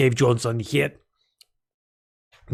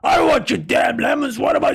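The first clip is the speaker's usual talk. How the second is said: Cave Johnson voice; 10 semitones higher than usual. Acted. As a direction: shout it like an angry CEO. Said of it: "And Cave Johnson say".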